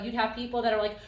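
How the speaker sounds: ordinary talk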